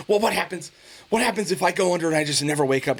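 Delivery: high, worried mom voice; imitating his scared mom; mom's scared question. Son retells his mom fearing surgery, high worried voice. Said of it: "And she goes".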